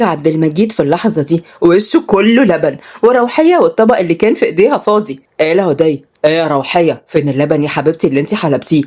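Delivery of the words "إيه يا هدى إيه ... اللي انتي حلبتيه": low male teacher voice; kindly young man. Acted. Tutor asks the little girl about the milk, low. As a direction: ask in a low, easy voice. Uday questions the child.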